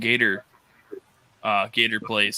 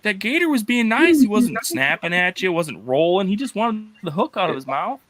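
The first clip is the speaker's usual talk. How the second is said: mock exasperated; 7 semitones higher than usual. Scolding the fisherman, retold.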